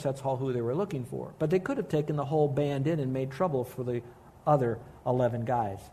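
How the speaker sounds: ordinary delivery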